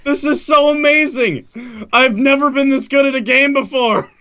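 high-pitched, giddy amazement; thrilled. Real, not acted. Player who has never been this good, thrilled. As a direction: gush in a high, delighted voice.

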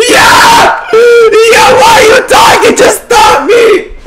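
very high, loud excited shout; triumphant screaming. Real, not acted. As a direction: scream in victory.

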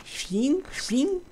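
high squeaky mock "Fin, fin"; mock falsetto. Mock ending, voice jumps up.

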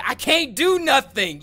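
mocking whine. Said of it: "could y'all hear him say".

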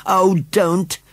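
high-pitched plea; pleading. A narrator's reading. Susan begging the scarecrow not to sulk, high pleading. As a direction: plead with dismay. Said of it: "pleaded Susan".

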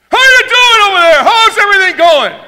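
very high, very loud over-eager greeter; imitating Bobby. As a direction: blast a greeting at people coming in. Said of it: "what does Bobby do ... attacks".

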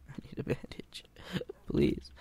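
faint whisper with a laugh; mock deathbed plea; dying whisper.

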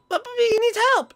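squeaky high-pitched friend voice; high character voice. Acted. Girlfriend answering brightly about dinner, high and chirpy.

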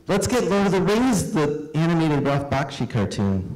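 put-on high, eager little boy; a hint of a pleading kid. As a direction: pitch it like an excited child. Voicing his younger self.

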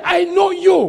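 ringing preacher's shout; booming pulpit proclamation; proclaimed shout. Acted. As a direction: declare it to the whole hall. Preacher proclaiming God's word.

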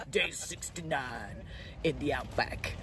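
documentary narrator. Acted.

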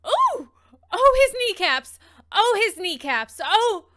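high, loud pained ooh; shocked wincing. Real, not acted. Viewer recoiling at a kneecap shot, loud shocked wince. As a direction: wince out loud at something gruesome.

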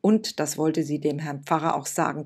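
ordinary delivery, nothing marked

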